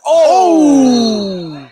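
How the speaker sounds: sudden loud high cry of excitement; real burst of excitement; excited shout. Real, not acted. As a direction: yell out at the big block. Big block on the court.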